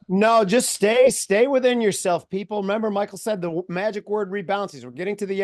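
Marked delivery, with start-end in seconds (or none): 0.1-2.3 slow, louder, deliberate words; slow emphatic advice. Real, not acted. Cohost urging listeners, slow and emphatic. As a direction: lean on each word to the audience.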